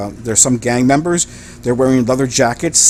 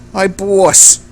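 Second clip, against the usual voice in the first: thick British henchman, almost Scottish; British accent sliding towards Scottish; muddled British accent; 3 semitones higher, 3 dB louder than usual. Acted. Henchman reporting visitors to his boss, muddled British accent. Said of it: "Are you Scottish?".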